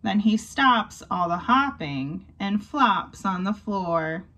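ordinary delivery

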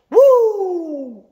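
very high, loud "Woo!" cheer; basketball fan whoop. Shown as a demonstration. Fan cheering a score, high loud whoop. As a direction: cheer like your team just scored. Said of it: "your team just scored".

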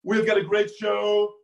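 hammed-up Trump, stretching the last syllable out long; Alec Baldwin as Trump. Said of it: "This is Alec Baldwin's impression of Donald Trump".

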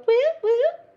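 high, loud whoop; excited cheer. Real, not acted. Host celebrates placing rhinestones, whooping loudly. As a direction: celebrate like a party.

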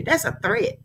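ordinary talk

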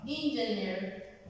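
"engineer" said slowly and clearly; slow English demo. Shown as a demonstration. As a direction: model "engineer" slowly for the class. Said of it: "Lo voy a pronunciar cuatro veces".

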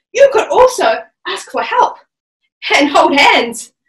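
ordinary delivery

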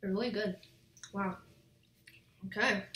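talking with food in mouth; chewing. Real, not acted. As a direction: mouth partly full. Seen 2: Bites toast at -2, then chews and wipes mouth with napkin while speaking.

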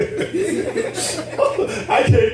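slow, laughing surrender; a preacher laughing as he mimics his own desperation; laughing mock plea. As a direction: give in to God while chuckling. Voicing his desperate younger self.

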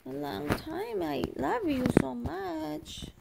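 put-on high, slow baby voice. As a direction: speak for the baby, high and tender.

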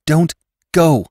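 high-pitched plea; imploring. Acted. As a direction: beg urgently, pitch lifted high. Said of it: "implored Jane".

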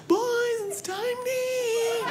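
very high, drawn-out call without volume; wife's pretend yell. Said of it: "I'll make her yell sometimes".

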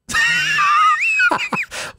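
high laugh. Real, not acted. Laughs after teaching line.

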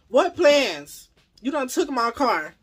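loud, high-pitched disbelief; indignant. Retold or imitated. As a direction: throw it back at him, exasperated and loud. Retelling her indignant reply to officer.

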